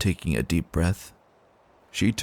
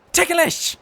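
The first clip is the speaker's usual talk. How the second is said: high, loud shriek of triumph; gleeful squealed yell; playful yell; 4 dB louder than usual. Acted. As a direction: shout it as you pounce. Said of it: "Dash yelled".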